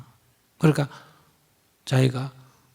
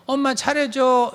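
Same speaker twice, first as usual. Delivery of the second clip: put-on child asking; 9 semitones higher, slower than usual. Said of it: "그러면".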